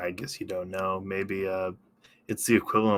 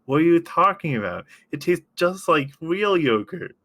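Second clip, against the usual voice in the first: higher, louder mock-incredulous voice; exaggerated high-voiced quote; imitating sketch character; 8 semitones higher, 4 dB louder than usual. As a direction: do a comic sketch impression, pitched up. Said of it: "where he's like".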